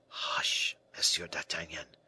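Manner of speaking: whispered hush, breath only; soft hush. Acted. Moliere begs D'Artagnan not to give him away, whispering. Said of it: "replied the man softly".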